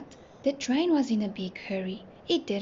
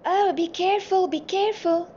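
high, louder alarmed cry; warning shout; 8 semitones higher, 5 dB louder than usual. A narrator's reading. Nelly warns the train of a rain cloud, high warning shout. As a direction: warn them urgently, pitched up. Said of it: "Nelly shouted".

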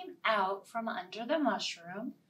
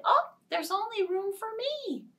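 high, clipped little ant; ant refusing; 11 semitones higher, 4 dB louder than usual. Acted. Ant telling butterfly no room, high. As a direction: brush her off.

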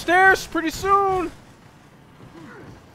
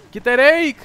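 ordinary talk

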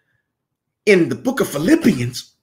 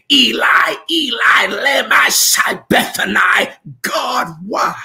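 shouted higher, voice breaking at the end; anguished cry; 4 semitones higher than usual. Acted. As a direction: call out to God in despair. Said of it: "we're saying".

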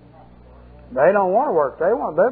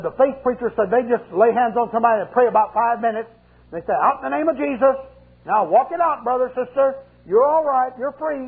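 higher, hammed-up healer's patter; mock faith preacher; 6 semitones higher than usual. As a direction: parody a breezy faith healer.

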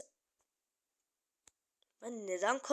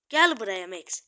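angry shout; 10 dB louder than usual. Real, not acted. Yelling at the enemy Max to come get it. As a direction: shout it, loud and hard.

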